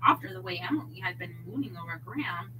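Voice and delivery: ordinary delivery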